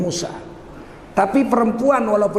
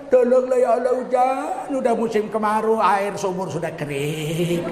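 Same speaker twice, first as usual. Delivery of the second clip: mock complaining prayer. Preacher mimicking a complainer begging God for rain, mock lament. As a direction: wail it out as a hammed-up complaint. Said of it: "Mengeluh aja kau kerjamu".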